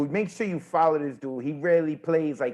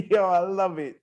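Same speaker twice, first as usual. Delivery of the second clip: ordinary talk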